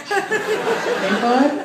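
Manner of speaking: very high, mock-friendly call; chirpy greeter. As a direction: sing out a goofy hello. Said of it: "Hey, why don't you".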